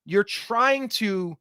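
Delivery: normal talking voice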